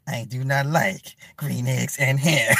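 low, slow mock storybook voice; deliberate, word-by-word comic read-aloud; Martin reading aloud. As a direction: imitate a sitcom man reading Doctor Seuss, drawn out. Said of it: "He's like".

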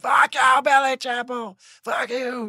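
high, shouted crowd taunt; a hammed-up high heckle; mock heckler yell. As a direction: jeer like an angry fan in the stands.

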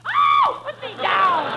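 high shriek, put me down. Acted. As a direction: shriek in alarm. Wife lifted like a log.

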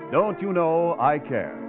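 ordinary delivery

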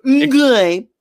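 high, pleased little hum; mock satisfied voice. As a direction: say it like a smug food critic. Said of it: "when we text each other".

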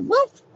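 high, loud imitated woof; dog bark. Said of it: "and you hear".

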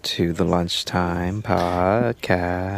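slow drawn-out welcome; slow host welcome. Acted. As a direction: stretch the welcome out. Show welcome, slowed.

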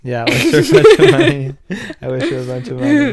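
high, loud laughing words. Real, not acted. Joking about wishing for money.

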